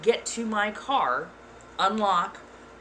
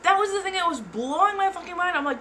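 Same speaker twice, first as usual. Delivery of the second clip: ordinary delivery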